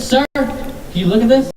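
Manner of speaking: high, sweet petitioner pitch; a pushy canvasser, voiced up high; mock petitioner voice. Canvasser stopping shopper outside store, high sweet voice.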